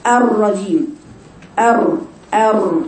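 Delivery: الرجيم with a long rolled ر, then أر أر slowly; تكرير الراء في "الرجيم" ببطء; over-trilled ra. Shown as a demonstration. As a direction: roll the ر hard and slow with a stiff tongue. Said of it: "لسانها ... زي المسطرة".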